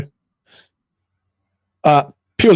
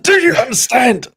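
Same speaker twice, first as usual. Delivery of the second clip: mock repeat demand; 12 semitones higher than usual. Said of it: "I said".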